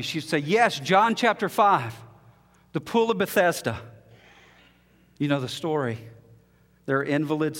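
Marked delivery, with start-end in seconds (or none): 0.4-3.8 eager request. Retold or imitated. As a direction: press for the passage, eager and hopeful. Woman eagerly requesting scripture.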